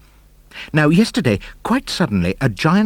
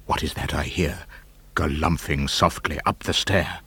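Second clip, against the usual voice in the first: soft, low and tense; hushed; 6 semitones lower, 8 dB quieter than usual. A narrator's reading. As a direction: whisper as if something creeps near.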